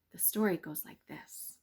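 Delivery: ordinary delivery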